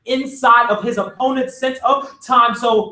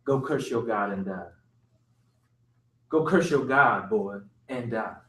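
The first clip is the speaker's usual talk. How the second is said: moaning; 12 semitones lower, 9 dB quieter than usual. A narrator's reading.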